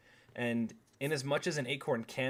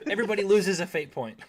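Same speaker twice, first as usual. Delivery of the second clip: high, raised-voice penalty announcement; game master overruling the racket; loud scolding; 6 semitones higher, 8 dB louder than usual. Real, not acted. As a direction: declare it loudly over the table. Game master penalizes noisy table.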